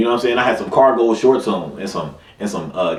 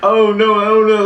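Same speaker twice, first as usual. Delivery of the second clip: mock crying kid; 8 semitones higher than usual. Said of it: "I'm crying the whole way".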